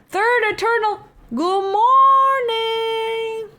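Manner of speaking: very high, slow stretched greeting; high sing-song greeting. Pretend. Greeting in put-on voice.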